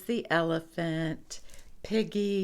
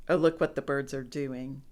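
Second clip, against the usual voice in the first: plain reading voice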